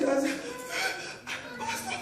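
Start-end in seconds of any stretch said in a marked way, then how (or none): 1.6-2.0 much higher, softer sobbing call; weeping. Retold or imitated. Preacher weeping to his pastor at church, higher, sobbing call. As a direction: squeeze the word out through tears. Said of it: "I was just weeping".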